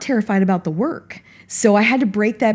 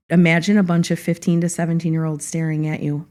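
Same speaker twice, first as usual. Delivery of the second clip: ordinary talk, nothing marked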